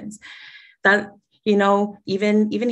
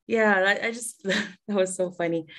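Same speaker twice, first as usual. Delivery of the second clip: usual speaking voice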